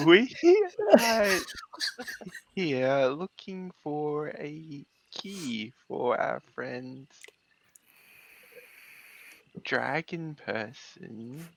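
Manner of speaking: very slow, drawn-out tree voice. Acted. Tree greeting strangers in a slow drawn-out voice. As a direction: stretch every word out slowly like an ancient tree. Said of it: "Why are you talking like that?".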